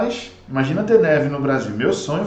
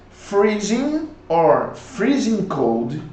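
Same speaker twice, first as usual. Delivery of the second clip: slow model; 3 semitones higher, much slower than usual. Shown as a demonstration. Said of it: "teaching vocabulary".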